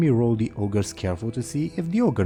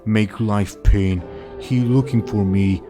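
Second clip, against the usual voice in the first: slow, dim-witted ogre bellow; dopey cartoon bellow; slower than usual. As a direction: boom it out like a simple ogre. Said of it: "the ogre says".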